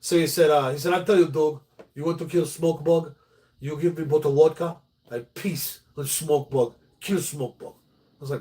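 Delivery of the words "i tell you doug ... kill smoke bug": mock Russian accent in broken English; Vlad's Russian accent. Vlad offering to kill the fire ants, mock Russian accent. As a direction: imitate the Russian neighbor offering a deal. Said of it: "we had a Russian guy next door".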